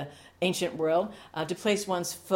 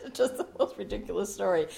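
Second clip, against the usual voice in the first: laughing; 3 semitones higher than usual. Real, not acted. Laughing at the story.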